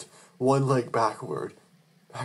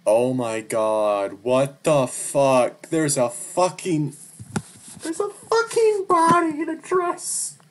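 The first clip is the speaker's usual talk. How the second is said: shocked horror; 4 dB louder, slower than usual. Acted. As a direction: recoil in disbelief at the body. Finding a body, shock.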